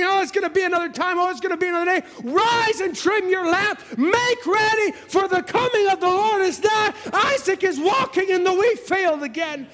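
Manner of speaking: high, urgent pulpit cry; herald's cry from the pulpit, pitched high; full-force preaching. Real, not acted. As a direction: rouse the sleepers with a preacher's call.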